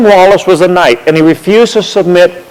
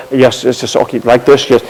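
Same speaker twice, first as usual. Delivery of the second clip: soft, lower, meek politeness; overly polite tourist; 5 semitones lower, 4 dB quieter than usual. Retold or imitated. Humoring the museum curator, mildly overly polite. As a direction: play the obliging tourist, lightly. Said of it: "be a polite tourist and talking like that".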